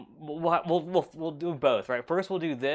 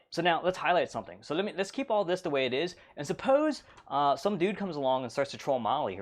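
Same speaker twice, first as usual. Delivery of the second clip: normal talking voice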